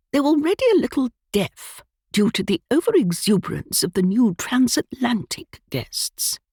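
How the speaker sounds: a hint of a gossiping old aunt. A narrator's reading. Aunt muttering to her nephew about guests, lightly voiced old lady.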